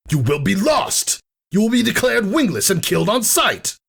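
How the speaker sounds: very high, shouted threat; enraged warning. A narrator's reading. Thunderhead to his sister, screaming warning. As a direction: warn her of death in fury.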